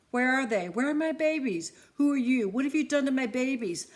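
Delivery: put-on a mother begging for her kids; desperate. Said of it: "in a desperate voice".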